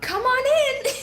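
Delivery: high, loud, cheery mock welcome; high cheery hostess voice; imitating mom. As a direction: play the mom waving a stranger in. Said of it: "She's like".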